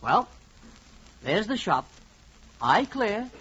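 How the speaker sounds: usual speaking voice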